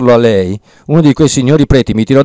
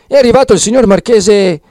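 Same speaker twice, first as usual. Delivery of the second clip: excited news; 11 semitones higher, 3 dB louder than usual. Acted. Renzo bursts in with news.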